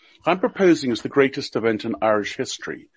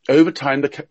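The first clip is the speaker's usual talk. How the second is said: normal talking voice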